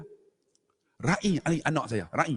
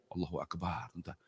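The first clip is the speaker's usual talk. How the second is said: whispered, much softer, in awe; hushed whisper; 9 semitones lower, 15 dB quieter than usual. Real, not acted. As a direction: breathe it out in a whisper. Awe at own harsh past.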